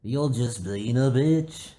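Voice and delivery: very slow, mocking little brother; bratty little brother. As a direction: drag it out to tease her. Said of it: "a fucking baby brother comes out".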